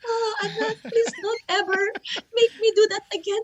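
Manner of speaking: high-pitched, wailing dramatic mom; telenovela melodrama. Retold or imitated. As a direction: imitate an overwrought soap-opera mother. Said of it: "on, like, a telenovela".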